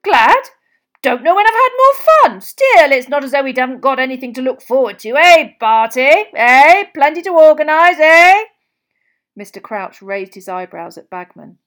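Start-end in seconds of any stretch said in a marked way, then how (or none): 0.0-0.5 high, loud, rising question; shocked. A narrator's reading. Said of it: "Ludo Bagman looked shocked". 1.0-8.4 very loud, high, jolly enthusiasm; gleeful. A narrator's reading. Bagman gleeful, nudging Crouch.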